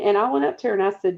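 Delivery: normal talking voice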